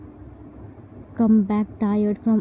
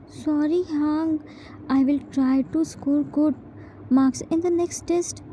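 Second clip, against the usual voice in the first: put-on small, higher apologetic kid voice; meek apology; 5 semitones higher than usual. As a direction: apologize meekly to a strict parent. Scolded child apologizing to parent.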